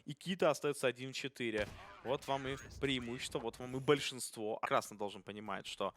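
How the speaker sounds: normal talking voice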